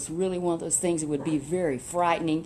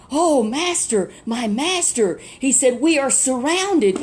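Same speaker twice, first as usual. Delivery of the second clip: alarmed servant; 4 semitones higher, 6 dB louder than usual. Retold or imitated.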